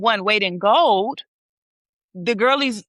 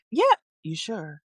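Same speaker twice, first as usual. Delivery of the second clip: doubtful quote; 3 semitones lower, 3 dB quieter than usual. Retold or imitated. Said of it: "they was like".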